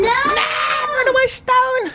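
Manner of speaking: high yelp of alarm; panicked shout. Real, not acted. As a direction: shriek at the stone in panic. Panicked yelling at stepped-on stone.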